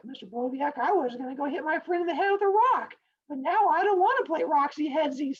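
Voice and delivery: high, whiny-kid imitation; mock student voice. Students tell teacher the story changed them, high mock-child voice. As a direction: mimic a child earnestly confessing to teacher.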